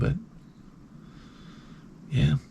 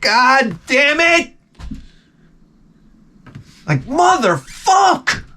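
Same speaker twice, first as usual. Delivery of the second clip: high-pitched, drawn-out cursing; mock pained outburst; 12 semitones higher, much slower than usual. Gentle pastor stubbing his toe, losing it in high fury. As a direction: explode on each word, stretched out and high.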